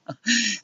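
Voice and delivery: mocking laugh. A narrator's reading. He laughs at Luciana as witch, mocking laugh. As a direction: chuckle at your own jibe.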